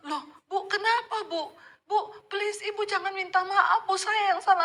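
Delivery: high, laughing flustered disbelief; an embarrassed man waving off an apology; bewildered protest. Retold or imitated. Confused pastor refusing her apology, high flustered laugh. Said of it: "Bapaknya malah bingung".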